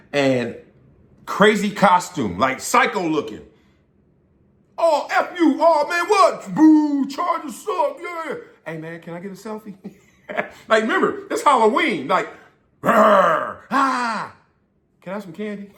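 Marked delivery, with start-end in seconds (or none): 4.8-8.4 rowdy heckling fan. Retold or imitated. Costumed Raiders fan heckling the visiting player, hammed-up high jeers. 8.7-9.8 meek fan request. Retold or imitated. Same fan suddenly polite. 12.8-15.7 mock trick-or-treater. Raider fans as trick-or-treaters.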